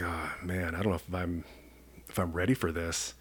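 mild nervous murmur to himself; nervous quiet mutter. Retold or imitated. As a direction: say it under your breath, dreading what's behind the door. Nervous before drill instructors' door.

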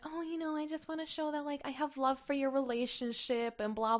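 high, soft imitation; mock sweet talk. Mocking Jess's gift speech.

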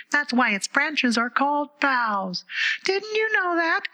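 very high, slow, shrill little flower; a tiny daisy crying out; squeaky daisy. A narrator's reading. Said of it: "cried another daisy".

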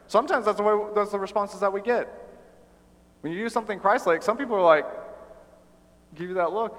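ordinary talk

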